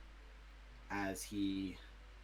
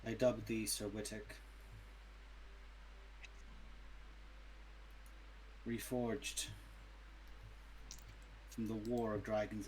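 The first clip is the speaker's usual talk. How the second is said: formal royal knighting speech; solemn ceremonial king voice; noble knight. Acted. King knighting player character.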